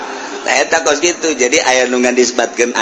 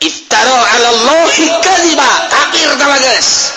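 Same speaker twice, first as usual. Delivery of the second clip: Quran recitation and preaching; 12 semitones higher than usual. Acted. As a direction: intone the verse then preach on.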